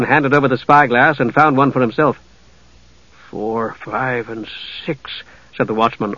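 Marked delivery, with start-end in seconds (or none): 3.3-5.1 much softer, counting under his breath in awe; hushed, counting ships in disbelief; awed counting. A narrator's reading. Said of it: "awed, counting".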